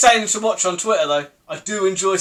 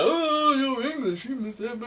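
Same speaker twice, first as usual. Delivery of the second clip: mocking Perry; 6 semitones higher, 5 dB quieter than usual. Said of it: "the easy thing to go for".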